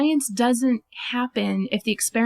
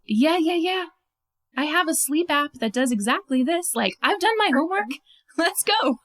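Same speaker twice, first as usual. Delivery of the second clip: high, quick, keen inner voice; eager impatience; 6 semitones higher than usual. Retold or imitated. Observer itching to be hypnotized, high and impatient. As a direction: brush it off, raring to go.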